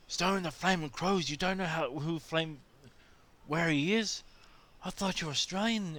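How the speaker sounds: high, disbelieving American tourist voice; a hammed-up tourist who can't believe a local doesn't know; high mock outrage, thrown at the Aussie; incredulous tourist.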